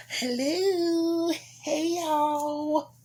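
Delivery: high, squeaky cartoon hello; squeaky silly greeting. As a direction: chirp it like a cartoon.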